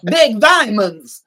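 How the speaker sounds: high, loud broken-English gold frenzy; crazed with greed, grabbing diamonds; greedy Homolka voice. Retold or imitated. Mocking the guide who is blinded by diamonds, high and loud. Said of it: "He goes full Scrooge McDuck".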